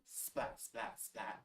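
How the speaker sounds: whispered then soft splats; lightning splat. Acted. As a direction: spit out the splats. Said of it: "He makes lightning".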